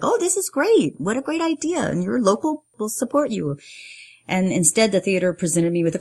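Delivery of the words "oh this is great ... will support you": high, gushing imagined welcome; mock-delighted, pitched way up; naive enthusiasm. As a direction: play an imagined supporter delighted by the idea. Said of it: "naively thought that the theater would be like".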